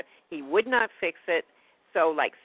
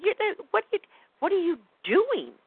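much higher, exasperated outburst; retold anger; 13 semitones higher, 3 dB louder than usual. Confronting the dentist over the bridge, angry and high. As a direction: fire the question back at him. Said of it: "I was angry at him".

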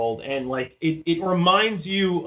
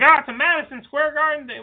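high, louder parody of hype; mock hype; 12 semitones higher, 4 dB louder than usual. Host mocking artificially made moments, mock hype. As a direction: oversell it as a forced big moment.

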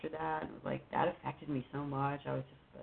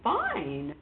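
daughter's reply; 7 dB louder, slower than usual. Retold or imitated. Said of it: "She goes".

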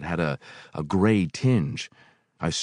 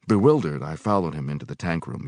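ordinary delivery

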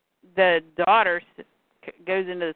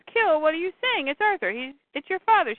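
higher voice, shocked and pleading; alarmed disbelief; 8 semitones higher than usual. Retold or imitated. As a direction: protest that he is her father. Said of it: "And she goes".

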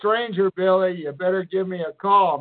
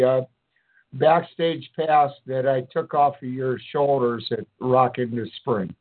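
ordinary talk

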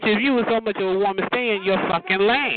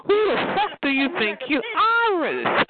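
high, drawn-out outrage; indignant scolding; 11 semitones higher than usual. Acted. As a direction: stretch it out high, putting someone in their place. Scolding the person being dominated.

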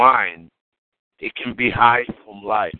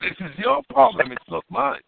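animated protest; 8 semitones higher than usual. Real, not acted. Disowning friend's weed plan.

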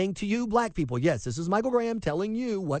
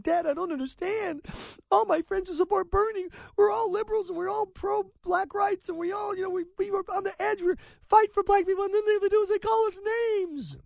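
put-on high girlish voice for his college-age daughter, much higher; imitating frustrated daughter; 14 semitones higher than usual. Dad mimics daughter on phone in high voice. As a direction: play the daughter whining about her friends, high. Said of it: "She's like".

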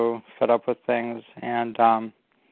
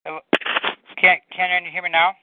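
nasal voice; 5 semitones higher than usual. Real, not acted. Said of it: "you sound super nasally".

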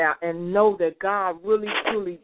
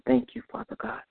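whispered prayer; 7 semitones higher, 5 dB quieter than usual. Real, not acted.

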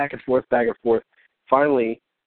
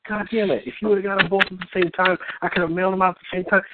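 higher-pitched retold exasperation; exasperated retort; 6 semitones higher than usual. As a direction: replay your annoyed comeback to the client. Retelling his annoyed reply to the client.

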